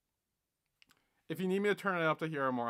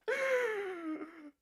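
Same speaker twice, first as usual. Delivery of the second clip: shrill embarrassed laugh; high laugh. Real, not acted. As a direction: burst out in a high cackle. Laughing at own video.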